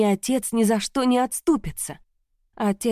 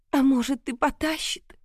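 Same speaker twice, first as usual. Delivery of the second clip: whispering through tears; 6 semitones higher than usual. Acted. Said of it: "прошептала сквозь слёзы".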